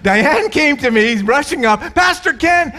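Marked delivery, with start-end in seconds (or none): 1.9-2.7 high, excited cry of a thrilled churchgoer; excited shout. Retold or imitated. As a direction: blurt it out like you just saw a miracle. Diane rushing up excited.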